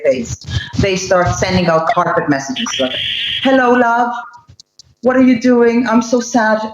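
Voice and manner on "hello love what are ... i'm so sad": higher, coaxing mock sympathy plea; sweet-talking narcissist. Ex reaching out to hook you back, coaxing and higher. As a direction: coo it like a manipulator angling for pity. Narcissist's hoovering message voiced.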